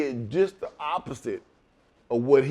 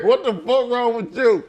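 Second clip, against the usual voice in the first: loud, high incredulous yell; mock outrage, pitched way up; indignant outburst; 9 semitones higher, 6 dB louder than usual. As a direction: throw it back at him loud. Indignant retort.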